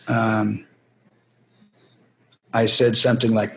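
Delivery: normal talking voice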